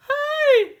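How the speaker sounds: high, loud call after her; indignant shout. Acted. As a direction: shout to stop the running princess. Frog calls after princess.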